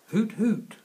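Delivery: high, loud owl hoots. Acted. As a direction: call out like an owl in the night.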